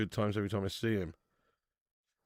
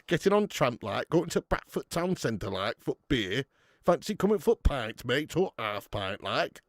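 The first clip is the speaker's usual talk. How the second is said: cheery Yorkshire lad heading to town; Yorkshire accent; 2 semitones higher, slower than usual. Retold or imitated. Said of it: "Yorkshire impression".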